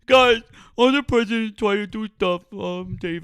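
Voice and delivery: high, loud mock whine; mocking imitation. Host parroting opponent's argument back at him, mocking. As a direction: make fun of him in an exaggerated high voice. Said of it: "I was just making fun of you".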